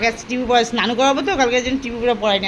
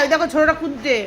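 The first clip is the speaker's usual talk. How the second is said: normal talking voice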